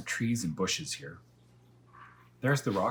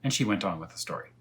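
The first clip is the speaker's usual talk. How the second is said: ordinary delivery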